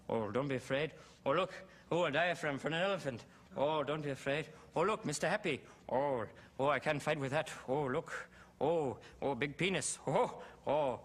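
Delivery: senile comic firing off random oohs and exclamations; old funnyman's disjointed little bursts, delighted by nothing; Robin. Acted. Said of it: "I was doing Robin like senile, like age seventy".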